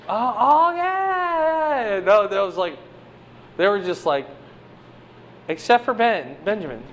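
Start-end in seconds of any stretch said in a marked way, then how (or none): 0.1-2.0 high, drawn-out comic wail of dread; hammed-up cry of alarm; mock panicked wail. Acts out the brothers' panicked reaction.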